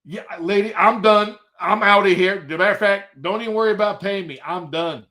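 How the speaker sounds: retold agitated quitting; panicked refusal. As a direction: act out wanting out right now. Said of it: "I went like this".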